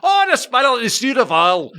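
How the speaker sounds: loud, high, garbled Glaswegian; mock Glaswegian accent. American imitating a Glasgow caller he can't follow, exaggerated Glaswegian accent. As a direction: slur it into hammed-up Glaswegian gibberish. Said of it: "a Glaswegian accent to me sounds like".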